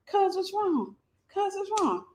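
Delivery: high, soft nonchalant question; calm. Retold or imitated. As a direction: ask it totally unbothered. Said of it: "Just as calm".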